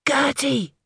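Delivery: a hushed but urgent call, pitched high; loud whisper. A narrator's reading. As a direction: call her urgently under your breath. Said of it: "I whispered loudly".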